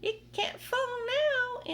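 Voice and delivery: very high, slow, loud drawn-out cry; shouting aunt. Acted. Aunt Sponge as the peach touches ground, slow high loud cry. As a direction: wail it out. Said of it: "Aunt Sponge shouted".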